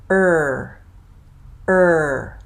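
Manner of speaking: er with lips tight and tongue curled; tight er sound. Shown as a demonstration. Teacher modelling the R-colored er vowel. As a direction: hold a tense, curled r-colored er. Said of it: "my lips are very tight".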